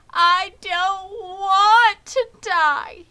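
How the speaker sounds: high, loud, slow crying moan. Acted. Wilbur bursts into tears over dying, moaning high and slow. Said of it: "he moaned".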